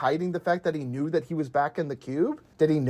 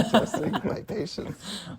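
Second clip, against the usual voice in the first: slow, softer quote through laughter; imitating exasperation; 6 dB quieter, slower than usual. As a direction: stretch it out while laughing. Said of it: "this is totally Cameron".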